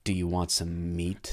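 low, slow and hushed; creepy offer. Shown as a demonstration. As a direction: make the offer sound creepy, slow and soft. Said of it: "Those are t-, same words".

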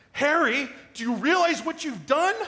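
high, loud, exasperated movie quote; Lloyd Christmas. Retold or imitated. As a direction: blurt out the quote as a stunned, annoyed Lloyd. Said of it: "like Lloyd Christmas said to Harry Dunn".

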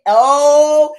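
ordinary talk

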